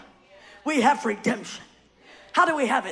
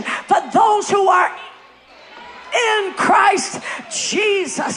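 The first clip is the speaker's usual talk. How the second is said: rising, ever louder preaching climax; full-force preaching; 3 semitones higher, 7 dB louder than usual. Acted. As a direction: build it up into a roaring proclamation.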